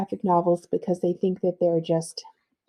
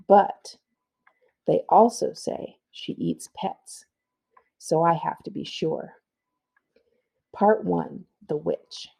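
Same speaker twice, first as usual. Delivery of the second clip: measured read-aloud storytelling; story narration. A narrator's reading. Librarian reading the narrator's boxes in storytelling voice. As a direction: draw the kids into a creepy tale. Reads graphic novel narration.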